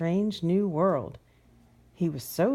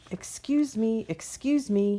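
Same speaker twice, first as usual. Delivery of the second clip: higher-pitched mild storybook shout; calling out; 5 semitones higher than usual. A narrator's reading. Moth flies over to a colorful stranger, calling out mildly. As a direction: call out lightly to get attention. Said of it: "he shouts as he flies over".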